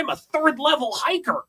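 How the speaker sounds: high, laughing nerdy voice; mock a proud dork, giggling; dorky voice. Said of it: "dorkiest way that I've ever heard".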